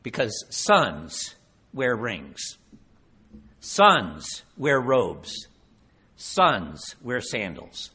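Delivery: each phrase slow and weighty, falling at the end; slow emphatic repetition. Real, not acted. Preacher to congregation, slow and emphatic. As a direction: hammer home what sons wear, slowly. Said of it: "preacher drives the point slowly".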